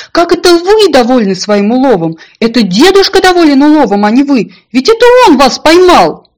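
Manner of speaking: high, loud, building protest; little donkey crying out in outrage; indignant shouting. A narrator's reading. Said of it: "закричал маленький ослик".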